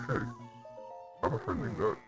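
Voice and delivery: ordinary talk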